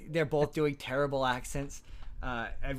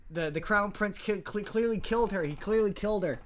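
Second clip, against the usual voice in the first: a bit higher and louder, pushing the accusation; overly insistent; 7 semitones higher, 4 dB louder than usual. Retold or imitated. Character accusing the prince to police, mock overinsistent. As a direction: insist too hard so it sounds guilty. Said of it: "he's too insistent".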